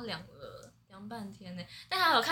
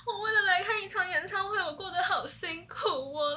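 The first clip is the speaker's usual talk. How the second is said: half-laughing, half-crying, higher and louder; tearful laughing lament; 9 semitones higher, 5 dB louder than usual. Retold or imitated. Fan retelling her exhausted night to friends, crying through laughter. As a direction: wail it like a worn-out fan. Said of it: "我就边哭边讲".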